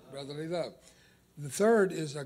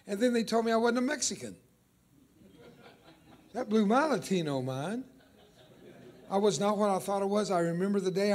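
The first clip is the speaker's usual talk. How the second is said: heightened emotional telling; 4 semitones higher, 5 dB louder than usual. Real, not acted. Pastor recalling childhood identity shock.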